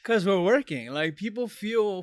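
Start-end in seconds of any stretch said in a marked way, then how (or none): 0.0-0.9 loud, raised comeback; punchy exasperated half-shout; exasperated retort. Acted. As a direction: fire back at nagging callers, mock-indignant. Shouted retort to imagined callers.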